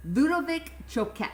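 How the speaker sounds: mock Italian accent, playful; bad Italian accent. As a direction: roll Ludovic Choquette out in a mock Italian accent. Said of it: "Don't clip the bad Italian accent".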